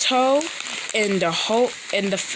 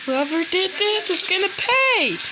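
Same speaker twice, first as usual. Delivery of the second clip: screaming; 11 semitones higher than usual. Acted. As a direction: scream the threat out in rage. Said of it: "Him screaming".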